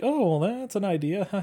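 high-pitched, softened imitation, ending in a laugh; mock reaction. Retelling the director's reply to a nomination, mock reaction. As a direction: pitch it up like someone humouring a suggestion. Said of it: "then he's like".

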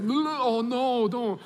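high-pitched comic "oh no"; mock dismay. Speaker to himself after blurting it out, mock dismay. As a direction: act out trying to take the words back, voice up high. Regretting words just said.

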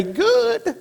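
high sharp approving Good. Acted. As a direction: chirp it up high, pleased. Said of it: "And I say".

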